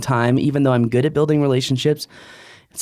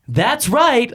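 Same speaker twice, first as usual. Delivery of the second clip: excited; 10 semitones higher than usual. Retold or imitated. Mock reveal of the service.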